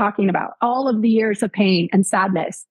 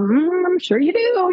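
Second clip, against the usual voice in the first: high, drawn-out sceptical humoring; mock humoring; 9 semitones higher, slower than usual. Reporter to healer who hears voices, mock humoring. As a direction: humor him with a slow, lilting hum.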